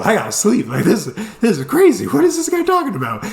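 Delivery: high-pitched comic exasperation; incredulous tentmate. Retold or imitated. Tired fifteen-year-old about his tentmate, incredulous. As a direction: climb to a squeaky peak of disbelief.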